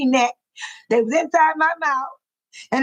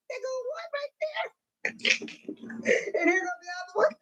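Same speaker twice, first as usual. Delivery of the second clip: high, faint voice squeezed by laughing; helpless laughter; 8 semitones higher, 11 dB quieter than usual. Real, not acted. Host showing viewers mismatched socks, laughing helplessly. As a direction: try to talk while cracking up.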